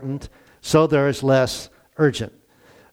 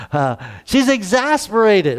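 exasperated sigh; 5 semitones higher than usual. Shown as a demonstration. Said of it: "makes you feel exasperated saying it".

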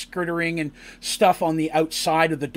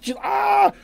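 anguished ah. Retold or imitated. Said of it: "they're just".

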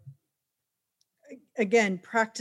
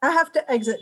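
raised voice over a blaring alarm; shouting over fire alarm; 6 semitones higher, 9 dB louder, faster than usual. Real, not acted. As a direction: call out loud over the noise. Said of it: "My fire alarm's going off".

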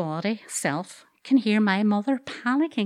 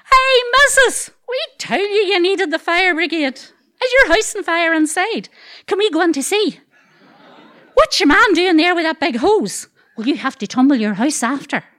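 put-on much higher, louder kids' heckling; cheeky wee boys; 7 semitones higher, 5 dB louder than usual. Wee boys on the wall heckling the missus, high and cheeky. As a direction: jeer at her like gleeful street kids.

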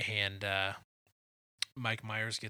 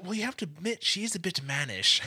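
higher, louder mock British quip; bad Austin Powers accent; 9 semitones higher, 8 dB louder than usual. Host quoting Austin insulting Basil's mother. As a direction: toss it off cheekily. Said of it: "Austin goes".

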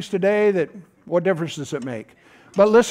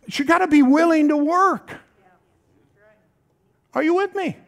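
high preacher's call to the crowd; preaching call-out; 9 semitones higher than usual. Real, not acted. Pastor calling the church for a response, high and pressing. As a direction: rouse the congregation.

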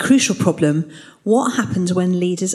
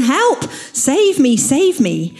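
shouting for help; 6 semitones higher, much slower than usual. Acted. Preacher voicing the drowning man shouting help from the river. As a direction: call out like a drowning man, pitched high. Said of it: "shouts again".

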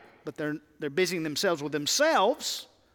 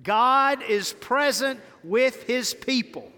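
like you're saved; 5 semitones higher, 4 dB louder, slower than usual. Shown as a demonstration. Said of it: "Now say it like you're saved".

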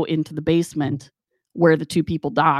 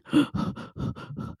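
huffing breaths in and out; imitated heavy breathing; 8 dB quieter than usual. Imitating Garvis going back to breathing, heavy huffs. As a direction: mimic heavy breathing after holding it. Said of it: "he goes back to breathing".

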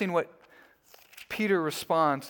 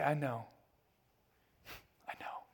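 quiet; 8 dB quieter than usual. Pretend. Peter answering the church gently, very soft.

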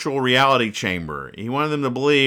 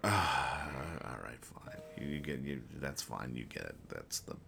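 much softer and lower, giving up; defeated mumble; 10 semitones lower, 18 dB quieter than usual. Real, not acted. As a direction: trail off, beaten by the joke. Gives up on the over-explained joke.

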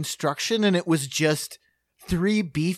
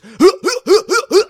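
loud, high, repeated effort grunts; mock exertion "huh", punched out in quick bursts; imitated tackling grunts; 13 semitones higher, 12 dB louder than usual. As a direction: grunt as if ramming a wall over and over. Imitates man tackling the wall.

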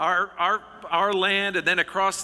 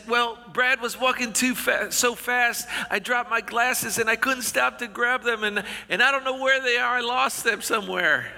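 put-on higher, whiny kid pleading his excuse; little whiny voice; 5 semitones higher than usual. Little brother excusing himself to mom, higher whiny kid voice. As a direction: whine it like a little boy caught out. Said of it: "in this little whiny voice".

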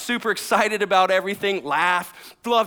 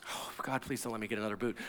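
very soft, low aside; half-whispered prayer under the breath; muttered plea; 8 semitones lower, 14 dB quieter, faster than usual. Real, not acted. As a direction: mutter a quick prayer to yourself. Muttered aside prayer about boots.

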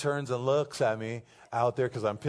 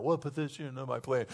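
softer, lightly imitated child question; little girl asking; 5 dB quieter than usual. Little girls always asking their coach, lightly imitated child voice. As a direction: mimic the little players' usual question. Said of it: "they always are asking that".

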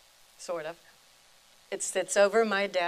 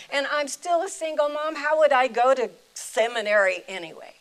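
higher, louder, piling up excuses in a rush; a woman arguing back, stacking objections; protesting excuses; 5 semitones higher, 7 dB louder than usual. Retold or imitated. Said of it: "First, I said".

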